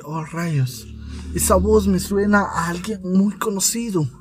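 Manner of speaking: soft, theatrical soap-opera suspense; telenovela voice. Acted. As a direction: muse quietly as if aside to the audience. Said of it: "una voz de novela".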